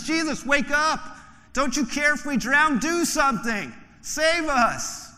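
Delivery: high, loud frantic cry for help; panicked plea. Acted. Disciples shouting at sleeping Jesus in storm, panicked and high. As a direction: voice the terrified disciples begging.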